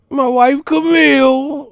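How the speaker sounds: high, loud, slow announcer call; ring announcer. Pretend. Mock ring announcer calling name.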